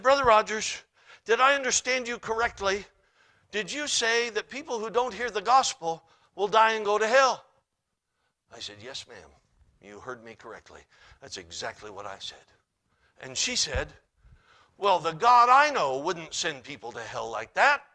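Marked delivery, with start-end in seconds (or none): 0.0-7.4 loud, high, incredulous challenge; indignant questioning. Retold or imitated. Woman challenging the preacher after his sermon, indignant. 8.8-12.4 low, soft, measured answer; composed reply. Retold or imitated. Preacher answering a challenging woman after service, composed. 14.8-17.8 loud, affronted protest; indignant objection. Retold or imitated. Woman objecting to the preacher's sermon, indignant.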